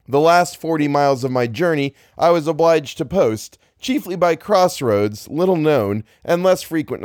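measured reading, pitched a little high; story narration. A narrator's reading. Narrator describing his journey by crossroads, story narration. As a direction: project it like an audiobook narrator.

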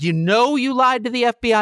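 a hint of a judge who can't believe it; incredulous judge. Judge questioning Flynn in court, lightly voiced incredulity.

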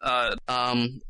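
ordinary delivery

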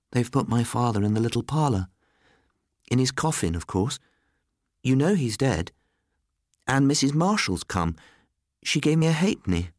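put-on high-pitched small boy, matter-of-fact; young Nicholas. A narrator's reading. Boy tells Miss Grey about his father, high child's voice.

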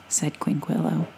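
plain reading voice

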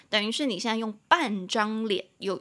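normal talking voice

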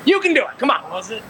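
normal talking voice

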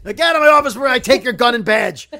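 loud, high, fast tirade; angry boss. Acted. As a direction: throw him out of the office. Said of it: "C E O trailer guy".

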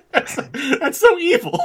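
real, helpless amusement; laughing. Real, not acted. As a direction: crack up while saying it. Laughing at the villain's cruelty.

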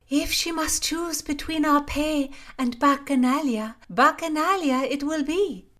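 high, loud gossip; a lady-in-waiting gossiping to Constance, high and loud. Acted. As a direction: spill the news eagerly. Lady mocks princess's spending.

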